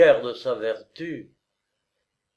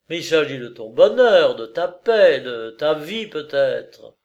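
urgent pleading; 2 semitones higher, 7 dB louder than usual. Acted. Pleading lover presses her.

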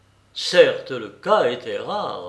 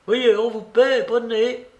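high, loud urging; insistent and keyed-up; urgent prompting; 9 semitones higher, 6 dB louder than usual. A narrator's reading. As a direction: press her to take it, voice raised. Narrator voices an excited exchange.

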